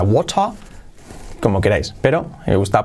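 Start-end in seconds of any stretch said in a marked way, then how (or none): 0.0-0.5 WATER as "wota", no final R, rising; variant without R. Shown as a demonstration. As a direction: demo water ending in an open A. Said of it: "gente que dirá water". (water como "wota", sin R final)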